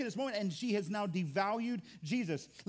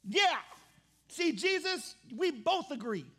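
higher, louder hyped-up Martha cheering her sister on; Martha agreeing; 8 semitones higher, 4 dB louder, slower than usual. Acted. Martha backing Mary up in front of Jesus, higher and louder. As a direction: egg her sister on like a churchgoer shouting amen. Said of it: "Martha's like".

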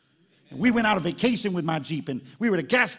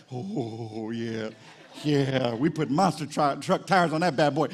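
deep contented growl; low satisfied gloat; 5 semitones lower, slower than usual. Pretend. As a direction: savour the new tires. Gloating over tires.

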